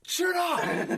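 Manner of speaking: high, loud sudden bellow; hammed-up outburst; mock shout. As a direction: yell the one word for laughs. Mimicking sketch, bellowed word.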